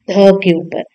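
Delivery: ordinary delivery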